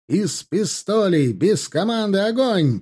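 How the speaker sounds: high-pitched battle order; shouted command. A narrator's reading. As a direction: call the pistol fire out high. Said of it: "прокричал Тимофей". (высокий командный крик)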